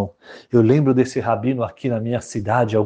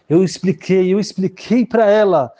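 high, louder, fed-up complaint; exasperated father; 8 semitones higher, 4 dB louder than usual. Acted. Father complaining his daughter would not listen, high and exasperated. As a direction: throw up your hands about the stubborn daughter.

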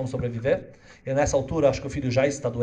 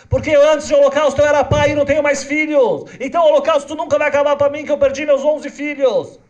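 higher, louder anguished cry of a grieving father; weeping rabbi; 13 semitones higher, 11 dB louder than usual. Acted. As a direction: pour out the loss of eleven children. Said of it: "O Rebbe começa a chorar e fala". (rabino chorando, voz alta e aguda)

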